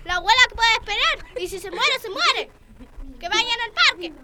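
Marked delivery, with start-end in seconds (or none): none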